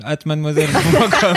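laughing through the words. Acted. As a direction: giggle through it. Joking fake email address.

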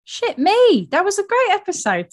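thrilled, voice jumping up; sudden high-pitched delight; excited outburst. Real, not acted. Recalling last year's great episode.

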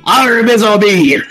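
put-on French pirate accent. Friend joking about French ships, in mock French pirate accent. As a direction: drawl it out as a giggling French pirate. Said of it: "I am a French pirate".

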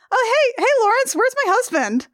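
high, breezy cheerful voice; high airy innocent wake-up; chirpy waking Juliet. Retold or imitated. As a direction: wake up bright and chirpy like nothing happened.

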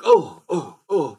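high, loud mock gasps; repeated hammed-up shocked exclamations. Audience reacting to the murder, mock shock. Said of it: "these people seem very shocked".

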